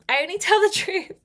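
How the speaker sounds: laughing through the words. Real, not acted. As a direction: giggle while saying it.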